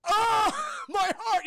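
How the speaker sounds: high, slow, loud wounded groan; mock heartbreak. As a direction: cry out as if stabbed, hammed-up. Character wounded by crush's words.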